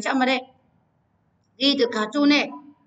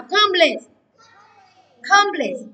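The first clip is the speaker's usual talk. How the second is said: Complete called out high, dropping on PLETE; read-after-me model; 7 semitones higher, 5 dB louder, much slower than usual. Shown as a demonstration. Teacher asking everyone to read along, high loud model. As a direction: project Complete for a class to echo. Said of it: "tất cả đọc theo nào".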